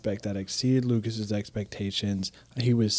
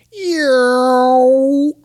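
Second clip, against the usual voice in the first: high, loud screeching "yow"; tie fighter yowl; 12 dB louder than usual. Retold or imitated. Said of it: "sound like a dude going".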